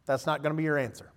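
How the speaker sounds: quiet, steady parent coaxing; calm parent. Retold or imitated. Father calming his frustrated three-year-old, quiet and steady. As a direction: soothe the frustrated kid down gently. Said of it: "And I'm like".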